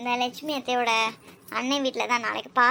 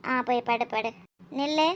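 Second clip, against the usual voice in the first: usual speaking voice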